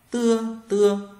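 "Tưa" with a plain Vietnamese t; high, loud, level "Tưa", drilled twice; teacher modelling "Tưa" without a puff of air; unaspirated T. Shown as a demonstration. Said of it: "chữ giờ bạn đọc thành chữ tờ".

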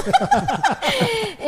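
high, loud laughter. Real, not acted. Joke about teacher and students.

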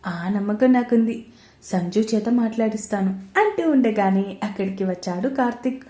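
usual speaking voice

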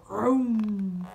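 loud lawnmower noise; engine vroom. Acted. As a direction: roar like a starting mower. Imitating a lawnmower.

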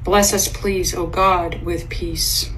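normal talking voice

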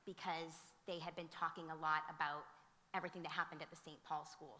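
ordinary talk, nothing marked

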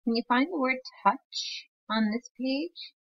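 normal talking voice

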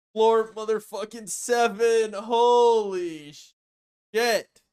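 high, slow excited shout. Real, not acted. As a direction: shout it out, amazed. Reaching floor seven, shock.

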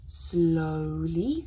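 plain reading voice

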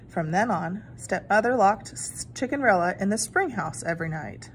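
usual speaking voice

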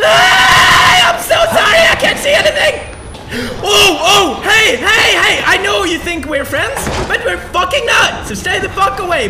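very high, loud frantic yelling; panicked screaming. Real, not acted. Panicking in dark game area.

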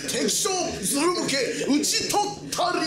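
high, proud victory call; triumphant war cry. Acted. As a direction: shout the victory like a samurai. Victory cry after winning fight.

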